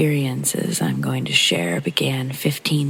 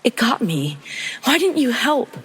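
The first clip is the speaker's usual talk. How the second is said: high, distressed cry for help; acted tearful outcry, pitch leaping up; crying yell; 10 semitones higher than usual. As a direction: cry it out in accusing panic, voice rising sharply. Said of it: "crying and yelling".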